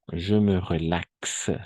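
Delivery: French said slowly, each syllable clear; teacher's slow demo of "Je me relaxe"; slow model pronunciation. Shown as a demonstration. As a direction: spell out "Je me relaxe" slowly for the class. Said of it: "Entonces vamos a decir".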